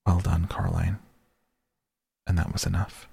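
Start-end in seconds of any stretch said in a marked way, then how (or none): 0.1-1.0 a hint of her real mother, far off; lightly voiced mother. A narrator's reading. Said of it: "a voice that sounded like her mother's".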